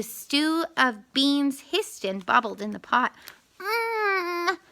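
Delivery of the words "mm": mm of pleasure. A narrator's reading. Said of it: "Anansi said".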